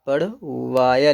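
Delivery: पढ़वाया drawn out syllable by syllable; slow dictation. Shown as a demonstration. Teacher giving students the word to write, slow dictation of पढ़वाया. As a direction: stretch out the long middle of पढ़वाया.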